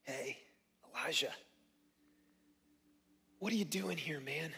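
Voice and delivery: soft, hushed voice of God; gentle whisper. Acted. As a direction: call to him very softly, with long pauses. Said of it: "came a gentle whisper".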